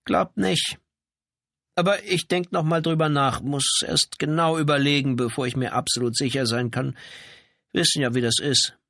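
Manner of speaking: high-pitched, hedging wife; evasive housebound wife. A narrator's reading. Janitor's wife holds back from policewoman, evasive. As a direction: pitch it up and stall for time.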